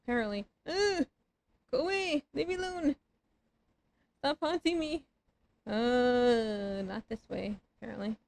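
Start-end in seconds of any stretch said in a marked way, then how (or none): 0.7-5.0 high panicked yells; being chased. Real, not acted. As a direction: yell at them to go away. Fleeing ghosts, yelling at them.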